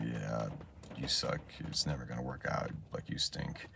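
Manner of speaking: low, muted self-put-down; negative inner voice. Retold or imitated. Speaker's inner voice during practice, quietly negative. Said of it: "voice in my head that's just, that was just very, very, very negative".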